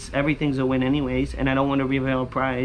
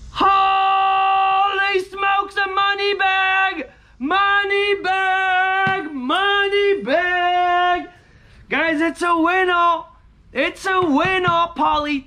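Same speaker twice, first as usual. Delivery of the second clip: high, drawn-out whoop of joy; excited outburst; 14 semitones higher, 3 dB louder, much slower than usual. Real, not acted. Scratcher hits a money bag, excited holler. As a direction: holler it high, stretching every word. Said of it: "I get so excited".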